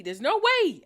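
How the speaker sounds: can't believe it, raise your voice; stunned, pushing the words out high and loud; incredulous. Real, not acted. Repeated louder in disbelief.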